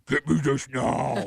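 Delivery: slow, demanding craving wife; slow, mock-bossy wife demanding food at night; demanding pregnant wife voice. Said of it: "And they do it in that voice".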